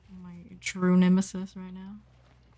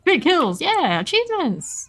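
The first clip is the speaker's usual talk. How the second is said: mock cheer; 10 semitones higher than usual.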